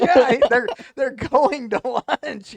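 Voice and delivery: words broken up by laughter; can't get it out for laughing. Real, not acted. Telling his friend the meth lunchbox story, laughing hard.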